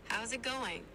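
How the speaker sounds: easygoing Australian accent; Aussie accent. Shown as a demonstration. AI assistant demonstrates for the user, Aussie accent. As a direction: ask it like an Australian. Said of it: "Can you do an Aussie accent?".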